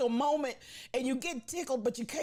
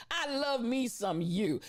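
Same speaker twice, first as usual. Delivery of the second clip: normal talking voice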